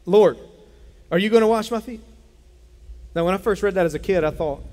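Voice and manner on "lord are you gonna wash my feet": higher and louder, disbelieving question; a startled disciple protesting; incredulous Peter. Acted. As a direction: Peter aghast at his Lord kneeling. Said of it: "Peter said to him".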